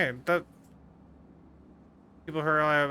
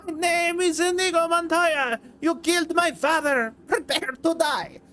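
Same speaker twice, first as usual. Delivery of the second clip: much higher, strained, over-the-top avenger; Inigo Montoya impression; 10 semitones higher than usual. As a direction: push the voice up until it nearly cracks. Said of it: "got too into trying to do an impression".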